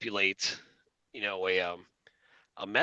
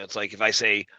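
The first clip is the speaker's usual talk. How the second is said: ordinary talk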